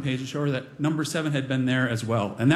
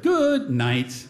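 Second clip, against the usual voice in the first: tucking-in good night; 4 semitones higher than usual. Retold or imitated. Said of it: "tucked her in and said".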